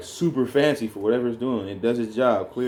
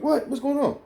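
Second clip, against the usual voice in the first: much higher, startled mock alarm; shocked confusion. New dad startled by baby's first cry, mock alarm. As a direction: jump in pitch like you're caught off guard. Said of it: "I'm like".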